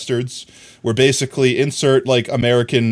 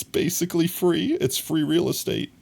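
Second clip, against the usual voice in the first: higher, softer meme line; 6 semitones higher, 4 dB quieter than usual. Pretend. Quoting the free real estate meme, higher and softer. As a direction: lift it into the meme tone. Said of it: "It's basically free".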